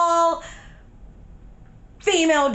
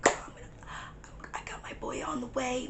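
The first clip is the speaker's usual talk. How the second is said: fast whispered plans; hushed hurried instructions; urgent whisper; 13 dB quieter, faster than usual. Retold or imitated. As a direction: whisper it quickly.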